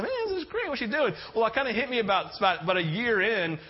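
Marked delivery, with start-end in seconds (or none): 0.0-1.1 much higher, pleased and puzzled; delighted surprise. Retold or imitated. Husband finding special dinner, pleased surprise. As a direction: gush happily, then wonder.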